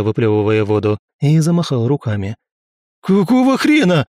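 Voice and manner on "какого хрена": much higher-pitched outcry; indignant yell. A narrator's reading. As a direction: yelp it as if just doused with cold water. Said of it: "завопил Романов". (возмущённый вопль)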